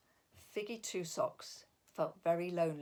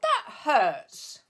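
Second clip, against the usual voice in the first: hurt complaint; 6 semitones higher, 8 dB louder than usual. Acted. Hit by snowball.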